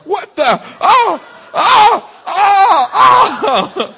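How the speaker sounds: high, loud panicked shrieking; a grown man shrieking at a nosebleed. Retold or imitated. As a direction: yelp in full panic like you're dying. Panics over a sudden nosebleed.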